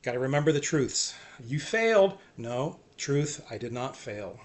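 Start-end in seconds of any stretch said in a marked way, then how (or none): 1.4-2.2 accusing enemy. Retold or imitated.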